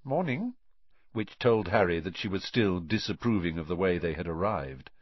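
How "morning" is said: higher-pitched, slightly stiff greeting. A narrator's reading. Disapproving girl greets the late arrivals, slightly stiff. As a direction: say it with a touch of stiffness. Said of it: "slight stiffness in the way".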